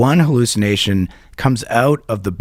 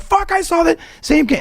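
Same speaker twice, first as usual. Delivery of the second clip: high-pitched stoned astonishment; amazed agreement. Retold or imitated. Tripping friend tells another he saw it too, amazed. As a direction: imitate an astounded tripping friend.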